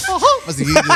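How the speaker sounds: high voice through laughter; laughing. Real, not acted. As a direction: laugh out the words. Laughing at Mickey Mouse joke.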